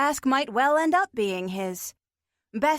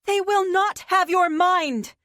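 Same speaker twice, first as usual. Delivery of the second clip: higher, louder fierce declaration; fierce cry; 8 semitones higher, 6 dB louder than usual. Acted. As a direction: Jessica defying the monsters, a fierce loud cry. Jessica fights for Siobhan's mind.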